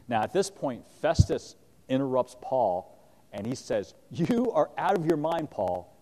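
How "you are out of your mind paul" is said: louder, higher outburst of disbelief; a governor cutting the prisoner off with a loud cry; shouted accusation. Acted. Festus interrupting Paul, a loud scornful shout. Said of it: "he shouted".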